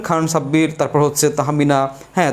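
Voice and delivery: ordinary delivery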